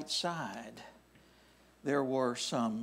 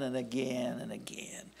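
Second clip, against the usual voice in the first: soft breathy hush, intimate; whispered blessing; 4 semitones lower, 8 dB quieter than usual. Acted. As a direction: whisper it, hushed. Sermon hushed emphasis.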